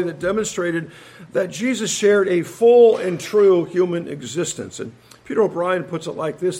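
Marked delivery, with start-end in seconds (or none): none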